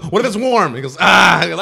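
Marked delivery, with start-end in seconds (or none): 0.1-0.7 high, loud imitated alarm; hammed-up panicked question; mock panic. Acts out fire drill panic. 1.0-1.4 loud, high imitated scream; hammed-up yell of panic; short fake shriek; mock scream.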